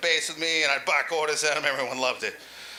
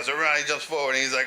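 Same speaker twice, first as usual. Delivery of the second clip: ordinary delivery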